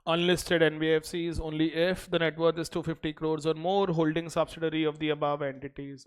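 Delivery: slow, even dictation pace. Acted. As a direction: read it out for notes. Dictates notes to class.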